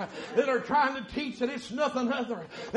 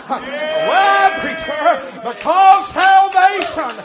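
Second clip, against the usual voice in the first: full-force preaching; 7 semitones higher, 13 dB louder than usual. Real, not acted. Preacher urging the congregation to rejoice, at full shout. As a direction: call the church up to shout.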